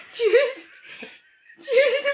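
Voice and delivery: high, loud, laughing "Choose". Acted. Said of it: "am I saying choose right".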